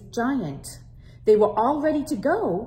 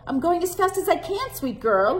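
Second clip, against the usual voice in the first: hurried grandma; 9 semitones higher, 3 dB louder than usual. Acted. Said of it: "Abuela said".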